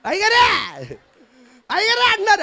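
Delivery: high, sing-song mocking call; villagers' mocking greeting. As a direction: tease him like the jeering villagers.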